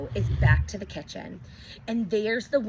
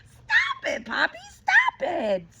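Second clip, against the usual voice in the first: squealing, much higher and louder yell; playful yell; 8 dB louder, slower than usual. Acted. Child squealing at Poppy chasing him with the hose. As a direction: shriek it like a kid under the hose. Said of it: "I yell".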